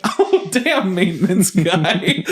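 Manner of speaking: high, slow laughing remark; laughing oh damn. Retold or imitated. Teasing the maintenance guy, high and laughing. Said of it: "I'm like".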